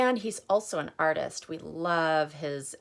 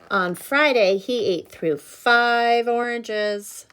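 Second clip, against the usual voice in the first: louder, slowed, emphatic picture-book reading with the hungry refrain swooping up; reading to children; 4 semitones higher, 7 dB louder than usual. Acted. Teacher reading aloud to children, dramatic refrain. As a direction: make every day a build to "still hungry".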